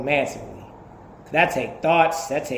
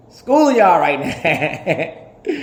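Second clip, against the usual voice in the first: slow, laughing brag; a real laugh through the words; laughing boast; 3 semitones higher, 5 dB louder, much slower than usual. Real, not acted. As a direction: stretch it out, cracking up.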